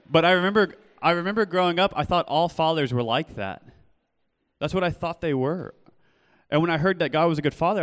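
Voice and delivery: high, loud, driving sermon delivery; preaching at full force. Real, not acted. Preacher raising voice to the congregation about fathers, loud and high.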